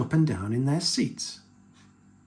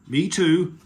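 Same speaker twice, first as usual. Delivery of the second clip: raised, hearty call; loud, higher-pitched reply; calling back; 5 semitones higher, 7 dB louder than usual. A narrator's reading. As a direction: call back happily to the child. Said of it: "called Daddy Pig".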